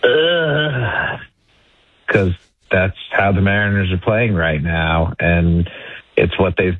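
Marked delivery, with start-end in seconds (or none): 0.0-1.2 guttural groan of disgust, pitched up; guttural ugh. Shown as a demonstration. Radio guest summing up how the team plays, guttural groan. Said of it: "like, a guttural intonation of".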